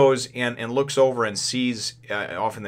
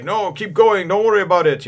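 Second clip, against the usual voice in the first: Frenchman; 8 semitones higher, 6 dB louder than usual. Retold or imitated. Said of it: "I just picture him being like this Frenchman".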